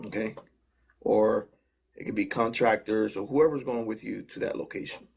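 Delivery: usual speaking voice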